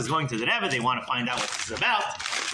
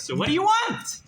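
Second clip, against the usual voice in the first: high, loud, fed-up question; exasperated; 4 dB louder than usual. Acted. Rebbe losing patience with Tadris, high and loud. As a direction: throw up your hands and demand it.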